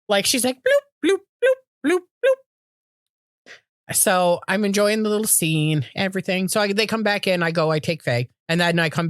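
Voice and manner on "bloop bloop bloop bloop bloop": very high, tiny popping bloops; hopping bloops. Retold or imitated. Imitating her little dog hopping in grass, squeaky bloops. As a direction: chirp little bouncy bloops, spaced apart.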